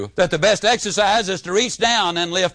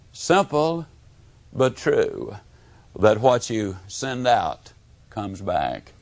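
ordinary delivery

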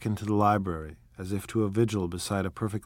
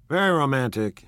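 flat agreement; 5 semitones higher, 5 dB louder than usual. A narrator's reading. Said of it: "and then miserably to me".

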